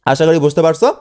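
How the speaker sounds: ordinary delivery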